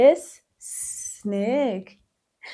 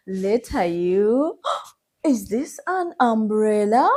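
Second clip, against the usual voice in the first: ordinary talk, nothing marked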